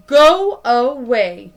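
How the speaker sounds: very loud, slow go away; dark chameleon chasing someone away; angry warning. Acted. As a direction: growl it to scare off an intruder.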